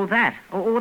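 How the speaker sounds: ordinary delivery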